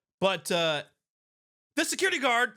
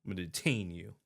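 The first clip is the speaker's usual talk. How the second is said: much lower, soft mock threat; mocking low mutter; 8 dB quieter, faster than usual.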